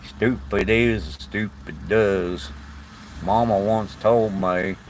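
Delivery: slow, put-on southern accent; Forrest Gump. Shown as a demonstration. Northerner quoting a film for viewers in a slow southern accent. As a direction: draw the words out in a mock southern accent. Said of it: "Probably sound like Forrest Gump there".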